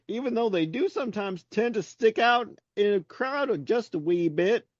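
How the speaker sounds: high, spooky ghost voice. Acted. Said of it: "I like your ghost".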